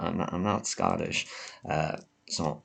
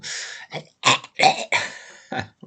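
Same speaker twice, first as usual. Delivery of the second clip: long loud coughing fit; real cough; 7 dB louder than usual. Real, not acted. Vlogger fighting a cold, long loud coughing fit.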